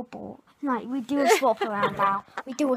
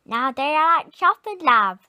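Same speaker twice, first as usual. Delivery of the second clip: 1 attempted Birmingham accent; 8 dB louder than usual. Shown as a demonstration. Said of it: "teach Clarice a Birmingham accent".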